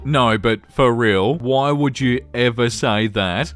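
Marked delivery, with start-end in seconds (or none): none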